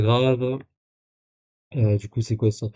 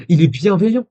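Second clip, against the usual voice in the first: raised, louder voice of disbelief; mock indignation; 7 semitones higher, 7 dB louder than usual. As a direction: exclaim it high, in mock outrage. Mock indignation at the other's message.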